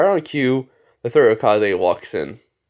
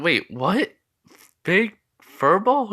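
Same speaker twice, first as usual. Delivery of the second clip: higher, puzzled disbelief, slowing at the end; mock surprise; 7 semitones higher, much slower than usual. Classmates react to the fur ball reveal, acted surprise. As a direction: question it as if astonished.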